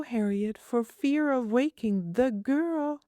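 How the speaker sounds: put-on high, prim little girl asking. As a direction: voice a fussy small girl, pitched high. Child voice continues.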